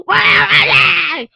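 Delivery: loud, high, drawn-out bellow; villain's roar. Retold or imitated. Child playing Vecna searching for the kids, loud slow mock roar. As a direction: bellow it slowly, hunting for them. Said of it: "he was like".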